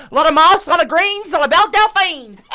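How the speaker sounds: high, loud, laughing bayou-guide cry. Acted. Guide hypes swamp sights to tourists, gleefully laughing. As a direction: whoop the list through giggles. Guide repeats list, cackling with glee.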